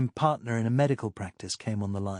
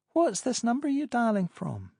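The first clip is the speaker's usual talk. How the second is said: lightly voiced woman, higher pitch; higher woman's voice; 12 semitones higher than usual. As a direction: play Pamela, amused and higher. Pamela asking on phone.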